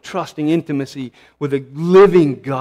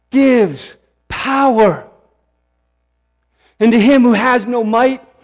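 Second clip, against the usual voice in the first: building sermon cadence; 5 semitones higher than usual. Real, not acted. Preacher building Isaiah's promise to the discouraged, rising to full force. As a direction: preach each word apart, then lift it up.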